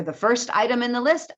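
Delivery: rising tune ending on "list"; list intonation. Shown as a demonstration. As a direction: read "first item in the list" as unfinished, pitch climbing at the end. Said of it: "then my intonation goes down".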